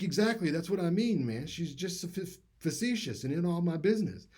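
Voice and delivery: emphatic, sure he's right; excited agreement. Retold or imitated. Will insisting his girlfriend is facetious, excited. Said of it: "he was like".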